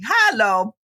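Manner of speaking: "Hello" as a sing-song HAH-lo; sing-song "Hello" like hallo; Hallo greeting. Retold or imitated. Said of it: "my son does it back to me".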